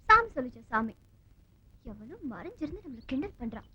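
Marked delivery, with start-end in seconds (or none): 1.8-3.7 very soft, secretive aside; hushed suspicion. Acted. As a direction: say it under your breath, suspecting a hidden listener. Suspects a hidden person is mocking them. (சந்தேகத்துடன் மிக மெல்லிய குரல்)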